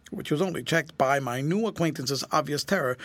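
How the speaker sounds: usual speaking voice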